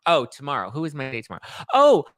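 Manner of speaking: usual speaking voice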